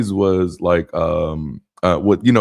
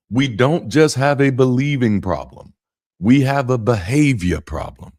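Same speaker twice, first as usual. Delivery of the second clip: louder, preachy proclamation; a touch of a stirring preacher; channeling Iyanla Vanzant; 4 semitones higher, 5 dB louder than usual. Acted. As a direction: lay it down like a sermon line. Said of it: "that's me channeling Iyanla Vanzant".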